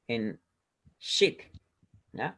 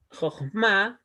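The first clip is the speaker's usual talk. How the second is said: "jochma" pushed higher on "ma"; stress on final syllable; 4 semitones higher than usual. Shown as a demonstration. Teacher splitting a Hebrew word into syllables, "jochma" stressed on "ma". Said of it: "aquí ma sí se acentúa".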